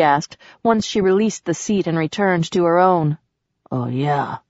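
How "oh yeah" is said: sarcastic. A narrator's reading. Man in pain answers her question, sarcastic. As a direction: lower and softer, dry sarcasm. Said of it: "he said sarcastically".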